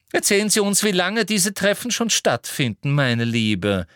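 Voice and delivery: high, coaxing, pushy voice; insistent Umbridge. Acted. Umbridge prompts Marietta about the meetings, insistent coaxing. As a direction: press the question, pitched high, then drop.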